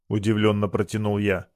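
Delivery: plain reading voice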